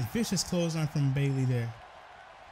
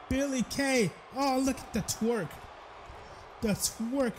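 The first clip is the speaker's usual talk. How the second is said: high, excited shout at the taunt; 8 semitones higher than usual. Real, not acted. Commentator yelling to viewers at the ring taunt, high excited shout. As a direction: call it out high as if you can't believe it.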